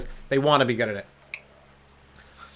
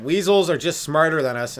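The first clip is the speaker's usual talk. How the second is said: ordinary talk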